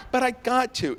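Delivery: mock childish insistence; pleading inner voice. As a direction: whine it like a kid. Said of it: "I'm like".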